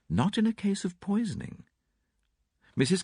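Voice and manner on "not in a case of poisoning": higher, calm, clear lady's voice; cool, composed interjection; Mrs Cavendish. A narrator's reading.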